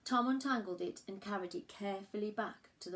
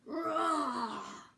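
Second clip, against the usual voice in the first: loud, high playful roar; a friendly dinosaur roaring back; 7 semitones higher, 5 dB louder than usual. Acted. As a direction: answer the call with a roar. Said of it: "answered with a rah of his own".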